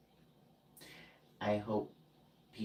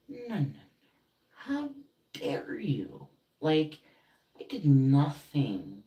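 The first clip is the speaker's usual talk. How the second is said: high, outraged comeback, louder on the last words; indignant retort; 8 semitones higher, 8 dB louder, slower than usual. Retold or imitated. Hurt friend throwing back the accusation, indignant. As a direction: stretch it out in rising outrage.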